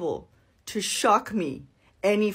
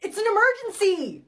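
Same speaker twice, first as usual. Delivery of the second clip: panicked plea; urgent high cry for help; 7 semitones higher than usual. Acted. Passenger pleading with attendant.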